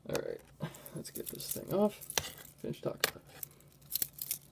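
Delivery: muttering to himself under his breath; whispered aside. Real, not acted. Aside to himself, removing watch.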